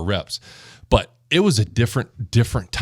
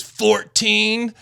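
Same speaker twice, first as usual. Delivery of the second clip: much higher drawn-out count; mock strain; 11 semitones higher than usual. Teammate hamming it up on the fourteenth rep. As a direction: stretch the number like mock effort. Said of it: "He pauses on fourteen".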